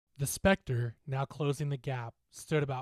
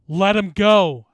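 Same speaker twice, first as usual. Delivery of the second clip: loud, slow, higher-pitched order; shouted command; 7 semitones higher, 19 dB louder, slower than usual. Acted. Narrator facing the specter to save the bully, shouting. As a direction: shout each word firmly at the creature. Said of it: "I shouted".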